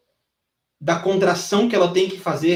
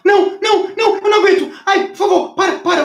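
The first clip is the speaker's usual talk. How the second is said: put-on high, loud strained plea of a girl who can't take it; pleading student; 7 dB louder than usual. Trainer imitates his student crying out to stop mid-set, high and loud. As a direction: squeal like a student begging to stop mid-lift. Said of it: "quando ela fala".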